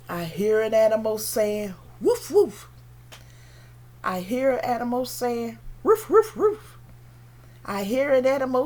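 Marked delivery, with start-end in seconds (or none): 2.0-2.7 high little woofs; short, high-pitched barking; dog bark. Acted. 5.8-6.8 quick, high, loud ruffs; short high-pitched barking; dog bark. Acted.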